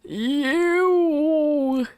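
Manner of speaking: disgusted ew. Real, not acted. Reacting to old middle school writing.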